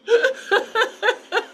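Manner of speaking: bursts into high, surprised laughter. Real, not acted. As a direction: laugh loudly at the spider. Spider jumps on her iPad.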